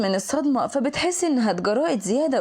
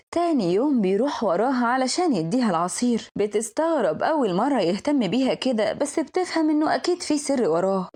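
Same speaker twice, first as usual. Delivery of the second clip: ordinary delivery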